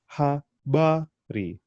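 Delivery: habari very slowly as ha, ba, ri; broken into syllables. Shown as a demonstration. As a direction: separate each syllable of habari with a gap. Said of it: "breaking up a, any word into its syllables".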